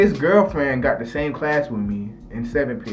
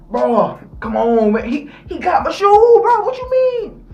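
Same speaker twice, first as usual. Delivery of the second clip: very high, whining outrage climbing up; hissy fit; 5 dB louder than usual. Retold or imitated. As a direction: squeal it, building to a tantrum. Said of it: "really having a hissy fit".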